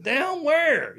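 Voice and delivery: high, loud puzzled question; baffled. Retold or imitated. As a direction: blurt it, totally lost. Retelling confused reply.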